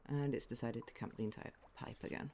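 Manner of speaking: ordinary talk, nothing marked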